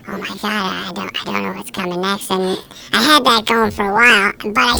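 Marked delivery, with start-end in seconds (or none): 0.1-2.3 much softer, lost inner voice; hushed stage panic; panicked mutter. Retold or imitated. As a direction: blank onstage, murmuring. Panic onstage.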